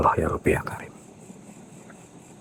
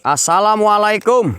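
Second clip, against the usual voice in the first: much higher and louder, a far-carrying call; calling out to a spirit; 13 semitones higher, 3 dB louder than usual. Acted. Ghost hunter calling the spirit to appear, loud high calls. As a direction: hail the unseen woman across the dark.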